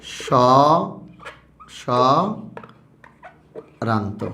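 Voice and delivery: স্বরান্ত stretched very slowly; teacher drawing out স্বরান্ত; drawn-out answer. Shown as a demonstration. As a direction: hold স্বরান্ত out for the class. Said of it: "মুক্তাক্ষরের একটা নাম কি বলতো".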